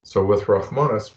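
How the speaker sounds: normal talking voice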